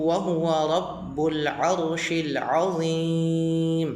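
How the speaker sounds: flowing measured chant with held vowels; slow Qur'anic recitation. Shown as a demonstration. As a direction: recite the whole prayer slowly, holding the final word long. Full model recitation of the prayer.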